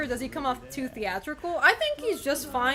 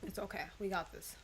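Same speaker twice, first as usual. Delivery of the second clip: soft self-reassurance; 6 semitones lower, 13 dB quieter than usual. Real, not acted. Calming self after death.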